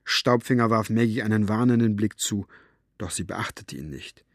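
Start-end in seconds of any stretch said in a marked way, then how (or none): none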